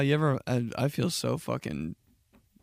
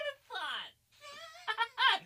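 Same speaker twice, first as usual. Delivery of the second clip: mock crying squeak; 6 dB quieter than usual. Mock shock continues.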